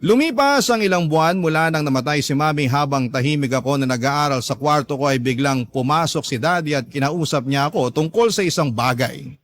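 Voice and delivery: usual speaking voice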